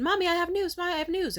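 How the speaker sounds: put-on high, loud, eager kid calling for mommy; excited child. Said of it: "I was excited to tell my mom".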